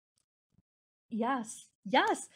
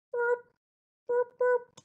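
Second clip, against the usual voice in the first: mock burps; 5 dB louder, slower than usual. Pretend kangaroo burping.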